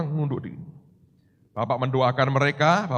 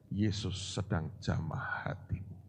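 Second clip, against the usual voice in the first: slow, low near-whisper; hushed reverence; 8 semitones lower, 14 dB quieter, slower than usual. Real, not acted. Preacher telling someone Jesus is touching their heart, slow reverent whisper. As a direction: drop to a slow, intimate hush.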